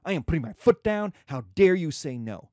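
father forcing Juliet to marry Paris; mock angry Capulet. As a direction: put your foot down hard. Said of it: "where Capulet says".

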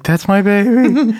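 high, drawn-out mock cry; distraught mother. Host imitating mother walking into campaign dinner, melodramatic high cry. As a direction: stretch it out as a hammed-up mother's wail. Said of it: "it's like".

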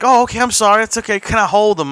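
high, eager apology; sorry, then beg to hold him; apologetic gushing. Retold or imitated. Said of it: "he's like".

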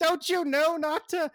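put-on high, loud panicked kid yell; Gizmo yelling. Gizmo yells at Cyborg after the crash, high panicked shout. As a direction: shriek it like a frantic little sidekick. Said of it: "starts yelling".

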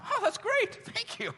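high, laughing mock delight; squeaky, overjoyed thank-you; delighted Zechariah. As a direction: gush with a laugh like a thrilled old man. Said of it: "You can just see Zechariah going".

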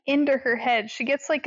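normal talking voice

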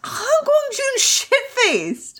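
very high and slow, each word stretched; high drawn-out echo. Acted.

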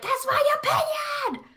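high screamy shout. Retold or imitated. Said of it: "But in a screamy voice".

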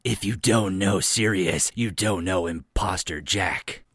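slow, deadly serious action-hero parody; imposter Jack Serious. Acted. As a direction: deliver it like a slow, solemn movie one-liner. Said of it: "[name]'s got the perfect voice for it".

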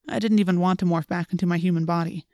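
ordinary delivery, nothing marked

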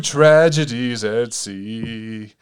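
slow, grave headline read; dramatic announcer. Acted. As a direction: announce it slowly like news.

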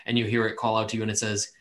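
ordinary talk, nothing marked

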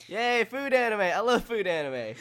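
over-the-top fake excitement, laughing; hammed-up enthusiasm for food anime; sarcastic cheer. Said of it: "I thought you'd be so excited for food anime".

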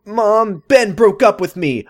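high mock-whiny complaint; upset teenage daughter. Daughter tells mom of breakup, high mock upset.

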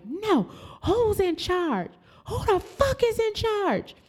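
high, screaming who's in charge; screaming demand. Retold or imitated. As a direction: shriek at them to get a boss.

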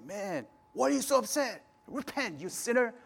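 high-pitched accusing mock condemnation; harsh judgmental rebuke. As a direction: call someone out as a sinner. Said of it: "judgmental, you know, like, harsh way".

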